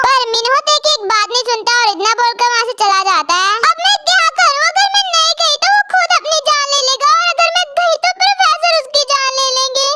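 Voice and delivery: ordinary talk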